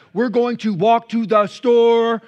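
loud, slow, exaggerated enunciation; high, booming, word-by-word simplified speech; talking to non-English speaker. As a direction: spell it out loudly and slowly for a foreigner. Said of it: "you think they don't know English very well".

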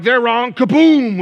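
loud, high, hammed-up smiting with a verse; mock Bible-thumping attack. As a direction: swing the verse like a club, mocking. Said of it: "You're like".

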